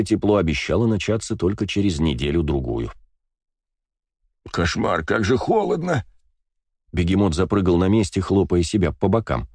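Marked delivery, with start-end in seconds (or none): 4.5-6.0 high, strained cry about the cold; freezing character voice. Acted. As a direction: yelp at the biting frost. Begemot freezing on platform.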